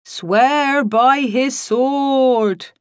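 loud, very slow, high intoning; banshee-like drawn-out command; eldritch ghost wail. A narrator's reading. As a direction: wail it upward to a strangulated end. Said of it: "rising in tone and pitch and finishing on a strangulated note".

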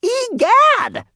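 put-on extremely high, loud boyish yelp; flabbergasted boy; astonished cry. A narrator's reading. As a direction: cry out in shock at the top of the voice. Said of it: "cried Jasper".

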